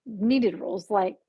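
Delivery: usual speaking voice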